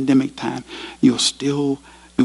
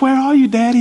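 put-on scared boy; 11 semitones higher, faster than usual. Boy tells his father the stairs fell, high and scared. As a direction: plead like a small trapped boy.

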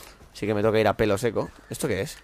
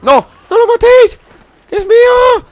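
shrill, very high screaming mock parent; hammed-up frantic mother; 15 dB louder, slower than usual. Gamer screaming very high as a mock mum at his allies. Role-play over allies' kill.

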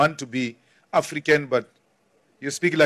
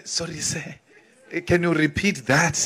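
much softer, meek and timid; submissive apology; 6 dB quieter than usual. Retold or imitated. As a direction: shrink back and ask politely. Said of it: "this submissive attitude".

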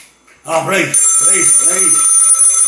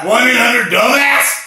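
mocking jab; 6 semitones higher, 5 dB louder than usual. Caller insulting the operator.

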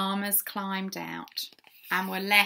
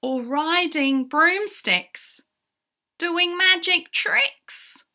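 rising, louder, higher read; excited storytelling; 8 semitones higher, 5 dB louder than usual. A narrator's reading. Narrator telling children about broomsticks and magic tricks, excited. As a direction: build wonder, stretching the last words.

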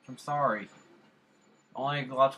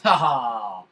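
loud laugh; 11 dB louder than usual. Real, not acted. Laughing at own joke.

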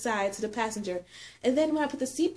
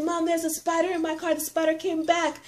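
retold panic; 7 semitones higher than usual. Calling mom about returning spider.

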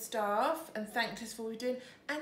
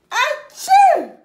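loud sneeze; real sneeze; 21 dB louder than usual. Real, not acted. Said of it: "Just like I did".

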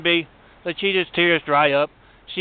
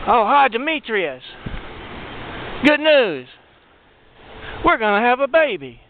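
excited phone news; 7 semitones higher than usual. Acted. Thief tells Demetrius on the phone, thrilled. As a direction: announce the baby with big excitement.

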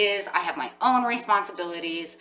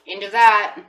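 louder, clipped final verdict; curt dismissal; 4 semitones higher, 11 dB louder than usual. Pretend. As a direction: shut it down with one firm line. Voicing client's dismissal.

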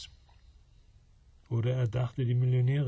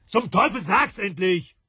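loud, high exasperated demand; angry shout; 9 semitones higher, 8 dB louder than usual. Acted. John losing patience with her, shouting. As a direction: bark at her to spit it out.

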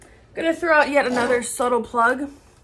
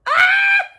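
very high, loud fangirl shriek; re-enacted scream of delight; excited scream; 6 dB louder than usual. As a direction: let out a piercing squeal. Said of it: "I screamed".

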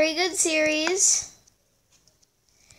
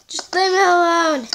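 loud, high mock whine; exasperated retold plea; 4 semitones higher, 6 dB louder than usual. Player retelling her reply to pestering fans, exasperated. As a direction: cry out to be left alone.

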